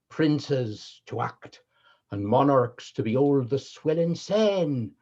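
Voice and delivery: grand Elizabethan Original Pronunciation chorus speech; Original Pronunciation accent. Shown as a demonstration. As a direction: declaim Shakespeare in Original Pronunciation. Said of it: "by doing the first half of the first chorus, uh, from Henry the Fifth in O P".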